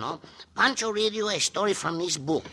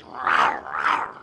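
low growling wolf rah; 4 dB louder than usual. Acted. Story wolves growl recalled.

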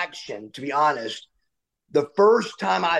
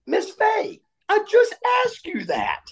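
much higher, strained cry at the teacher; unglued outburst. Retold or imitated. Student losing it at teacher Miss Fay, much higher outburst. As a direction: lose it completely, pitch flying up. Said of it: "I came unglued".

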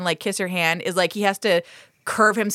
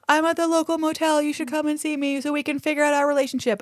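higher, put-on creepy voice; creepy suitor voice; 7 semitones higher than usual. Gare inviting Lillian to his motel, high and creepy. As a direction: play the needy creep, pitched up. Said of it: "is like".

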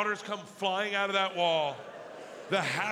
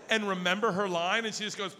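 usual speaking voice

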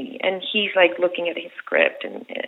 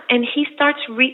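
ordinary talk

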